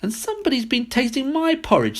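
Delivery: very high squealing cub; baby bear squeal. A narrator's reading. Baby bear finds his porridge tasted, high squeal. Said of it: "squealed the baby bear".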